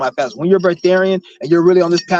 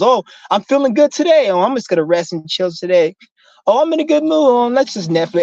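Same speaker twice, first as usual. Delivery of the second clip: higher-pitched mock of moody people; mocking imitation; 6 semitones higher than usual. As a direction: put on a lifted voice, mimicking their excuses.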